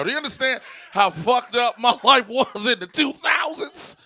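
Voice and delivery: loud, high, laughing rant; exasperated shout. Real, not acted. Asking the crowd about his old fashion, loud laughing shout. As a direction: shout it in disbelief at yourself.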